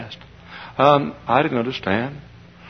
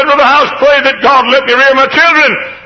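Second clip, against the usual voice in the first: very high, full-voiced cry; desperate plea; 11 dB louder than usual. Sick father begs to rear his children, desperate cry.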